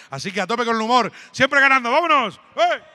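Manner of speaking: rising to a loud rally cry; stand-up comic firing up the audience; hype shout. Real, not acted. Hyping up the crowd.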